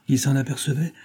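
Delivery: plain reading voice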